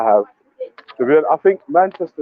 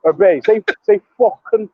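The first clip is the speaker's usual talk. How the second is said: mock Manchester accent; 6 semitones higher, much slower than usual. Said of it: "with that Manchester accent".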